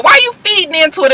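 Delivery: ordinary delivery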